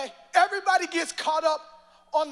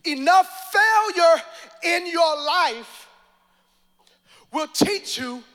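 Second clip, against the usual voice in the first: preaching; 3 semitones higher, 7 dB louder, slower than usual. Real, not acted. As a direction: hammer it home slowly. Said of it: "preaching climax".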